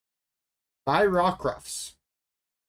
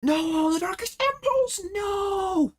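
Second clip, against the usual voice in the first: high squealing no; dismayed outcry; slower than usual. Real, not acted. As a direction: cry out in high-pitched dismay. Card gets hit, dismayed outcry.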